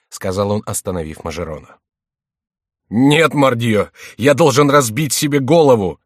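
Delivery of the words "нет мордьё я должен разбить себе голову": desperate shout. A narrator's reading. Young man shouting that he will smash his head. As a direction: shout it out in despair. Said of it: "вскричал молодой человек".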